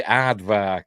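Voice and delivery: usual speaking voice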